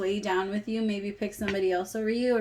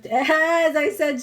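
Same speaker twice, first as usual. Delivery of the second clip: laughing; 7 semitones higher, 8 dB louder, slower than usual. Real, not acted. Laughs mid sentence.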